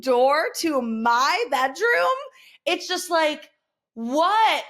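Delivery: loud, high comic outrage with laughs breaking in; exasperated rant. Real, not acted. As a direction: vent in drawn-out, laughing disbelief. Mock-exasperated rant about chores.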